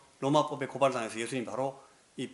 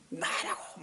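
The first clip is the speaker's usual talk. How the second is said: retold yell; 7 semitones higher, much slower than usual. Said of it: "소리 지르고 막".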